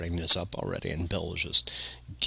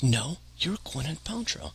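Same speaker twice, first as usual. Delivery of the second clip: much higher, slow mock insistence; retold insistence; 9 semitones higher, 4 dB quieter than usual. Drunk friend contradicting Cameron Diaz, high mock insistence. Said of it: "And he insisted".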